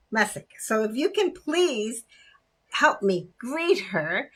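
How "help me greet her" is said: normal talking voice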